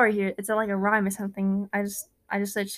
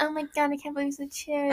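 put-on higher-pitched echo of the annoying girl; 4 semitones higher than usual. Co-host copying the annoying girl's reaction, higher mock-girl voice. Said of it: "then the annoying girl".

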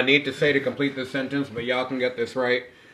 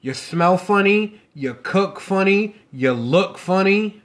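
slow, higher-pitched mock insults; teasing taunt; 7 semitones higher, 5 dB louder, slower than usual. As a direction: draw out each jab, loud and high. Invents insults to frame co-host.

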